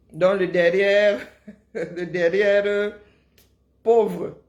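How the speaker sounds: slow, laughing over the word; giggling as she says it. Real, not acted. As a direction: chuckle through it.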